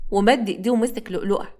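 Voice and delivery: ordinary talk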